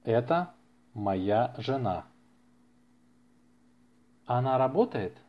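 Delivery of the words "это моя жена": ordinary delivery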